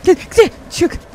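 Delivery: high, slow, loud desperate pleading; scared pleading; panicked urging. Real, not acted. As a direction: beg the character to get out.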